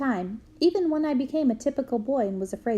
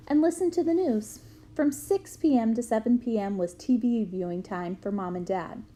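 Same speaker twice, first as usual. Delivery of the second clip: ordinary delivery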